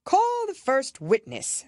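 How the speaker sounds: high, slow royal command; the king. A narrator's reading. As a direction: summon the witness slowly.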